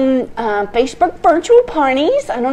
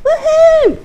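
high, loud whoop; woohoo cheer; 6 dB louder than usual. Real, not acted. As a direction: whoop for joy. Joy while piercing cake.

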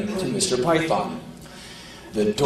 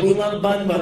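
put-on echoing kid; 7 semitones higher than usual. Kid echoes mother.

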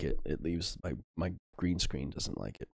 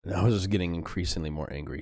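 ordinary talk, nothing marked

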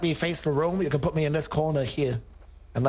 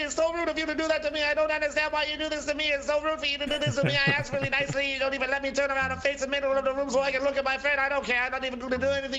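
higher-pitched, lightly voiced complaining old man; aggrieved old veteran; 7 semitones higher, faster than usual. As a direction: play a wronged old vet pleading his case. Plays old veteran Bill Dean.

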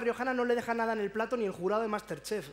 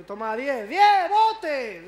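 loud, much higher yell on bote; shouting customer; 5 semitones higher, 11 dB louder, much slower than usual. Retold or imitated. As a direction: yell the tip over the noise. Customer yells tip over bar.

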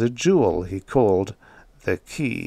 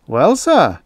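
high, loud apologetic opening; apologetic servant; 8 semitones higher, 7 dB louder than usual. A narrator's reading. Servant excuses himself to his master, apologetic.

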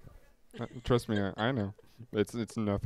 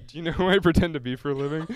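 mock diva; 5 semitones higher, 7 dB louder, faster than usual. Mock actor demanding recognition.

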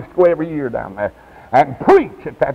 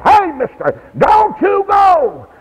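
high, drawn-out shout; urgent calling out; 5 dB louder, slower than usual. Acted. Devil calling to a sinner leaving, urgent high shout. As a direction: holler after someone to hold them back.

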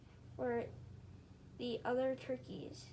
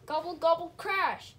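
loud refrain; 4 semitones higher, 8 dB louder than usual. A narrator's reading. Refrain read with gusto.

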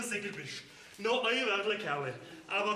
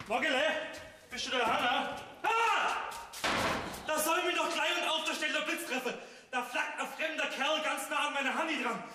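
agitated outcry; 4 semitones higher than usual. Acted. Bauer entdeckt fremden Kerl.

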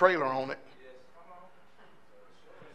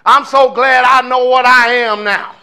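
mocking gushing quote; 5 semitones higher, 8 dB louder, slower than usual. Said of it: "ugly looking boy that had pink hair".

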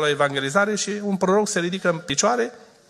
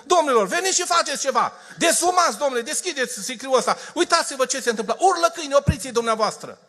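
urgent plea; 7 semitones higher than usual. Retold or imitated. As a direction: beg the authorities to act now. Shepherd pleading with authorities.

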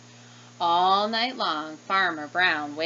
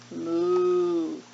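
long lowing moo; storybook cow moo; 2 semitones lower than usual. Acted. Cows mooing in picture book.

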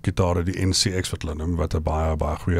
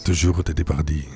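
"Toujours dit" said smoothly with a mock French r, lower; French accent on request; 4 semitones lower than usual. Shown as a demonstration. Asked to repeat it, guest mimics Parisian French smoothly. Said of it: "doen dit gou weer vir ons".

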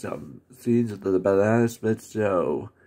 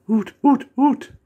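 high, loud owl calls; owl hoots; 13 semitones higher, 8 dB louder than usual. Retold or imitated. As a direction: hoot like a cartoon owl. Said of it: "Hooty going".

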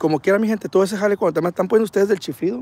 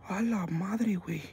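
stunned awe; 3 semitones higher, 12 dB quieter, much slower than usual. Real, not acted. Streamer staring at the ghost image, hushed awe.

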